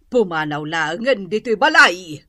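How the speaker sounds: normal talking voice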